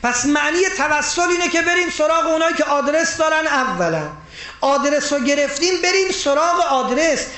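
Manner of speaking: high, loud full-force preaching; loud, high sermon delivery at full force; fiery preaching. Acted. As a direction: drive the point home to the congregation.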